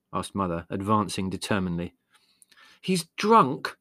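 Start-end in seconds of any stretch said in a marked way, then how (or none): 2.8-3.8 exasperated Margot, high and loud; despairing. A narrator's reading. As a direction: throw it out in high-pitched despair. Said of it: "said Margot despairingly".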